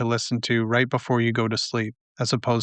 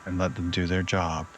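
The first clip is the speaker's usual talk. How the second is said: guided meditation; 3 semitones lower, 6 dB quieter than usual. Acted. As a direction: ease the listener toward sleep, unhurried and hushed. Leads a nighttime meditation.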